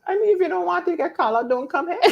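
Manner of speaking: laughing tease. Real, not acted. Host teasing a regular viewer, laughing and shouting. Teasing Jacqueline for getting called out.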